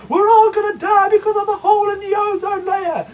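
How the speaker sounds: high, loud panicky shout; hammed-up doom shout; mock panic. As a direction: wail it like the sky is falling. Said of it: "Then it was".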